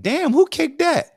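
high, slow astonished outburst; amazed disbelief. Retold or imitated. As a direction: gape at the impossible kick. Teammate amazed by huge kick.

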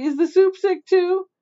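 high puzzled question; worried. A narrator's reading. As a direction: ask it with silly worry. Silly cousin asks worried.

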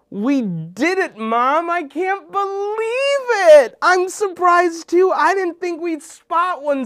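overjoyed shout of victory; elated. Acted. Triumphant photo success.

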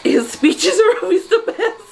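high laughing praise; teary laugh. Real, not acted. Vlogger laughing tearfully about the speech. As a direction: giggle it through tears.

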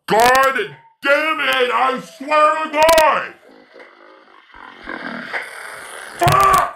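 high, loud rage; furious yelling. Real, not acted. Gamer screaming after failing the last try. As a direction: scream in fury.

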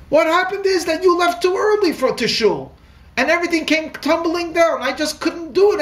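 tearful outburst. Retold or imitated. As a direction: near tears, still accusing him. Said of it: "And she begins to cry".

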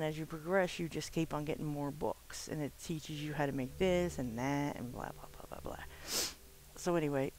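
ordinary talk

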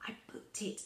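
silent mouthed whisper. A narrator's reading. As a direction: breathe it with no voice, just mouthing. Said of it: "She mouthed back".

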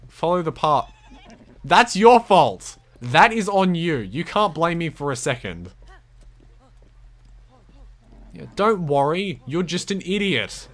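loud, slowed, indignant telling-off; scolding. Real, not acted. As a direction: blame the horse, mock-angry. Scolding his horse.

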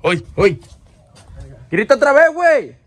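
loud, high-pitched searching yell; urgent shout. Real, not acted. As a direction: holler to someone out of sight. Calling to friends he cannot locate. (grito urgente, buscando a alguien)

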